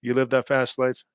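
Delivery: normal talking voice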